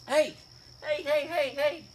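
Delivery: high, quick repeated heys; sudden high-pitched interjection; calling out. Real, not acted. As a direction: call out to get a little one's attention. Breaks off lesson to call out.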